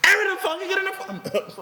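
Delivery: high, laughing repeat of the news; stunned, laughing echo; laughing disbelief. Retold or imitated. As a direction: laugh at the blow you didn't see coming. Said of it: "Say what?".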